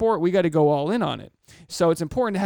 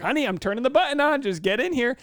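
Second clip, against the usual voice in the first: calling out; 9 semitones higher than usual. Retold or imitated. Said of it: "every single episode of the podcast is like".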